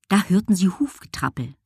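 ordinary delivery

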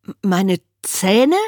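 slow, halting meine Zähne; a hint of a stammering boy. A narrator's reading. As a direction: stumble over it, puzzled. Alfie stammers.

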